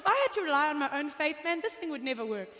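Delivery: normal talking voice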